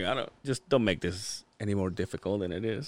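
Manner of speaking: a hint of tired pleading; weary quiet plea. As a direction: mutter a tired brush-off. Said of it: "You're like".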